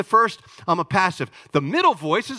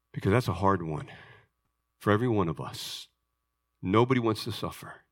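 hushed and solemn; 11 semitones lower, 5 dB quieter than usual. Real, not acted. Pastor confides to his church, hushed and grave. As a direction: drop to a hushed voice over the martyrs.

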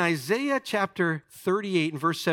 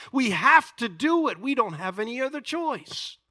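rising, louder exhortation to the church; full-force preaching; 7 semitones higher, 4 dB louder than usual. Acted. Preacher urging his congregation to live the truth, full-force preaching. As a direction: preach it hard, pushing the congregation to act.

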